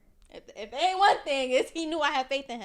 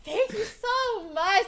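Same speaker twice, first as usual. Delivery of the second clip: grateful thanks; 9 semitones higher, much slower than usual. Retold or imitated. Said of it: "I'm like".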